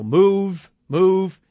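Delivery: loud, urgent retold order; flight crew yelling. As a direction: push the crowd with two sharp commands. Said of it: "the flight crew saying".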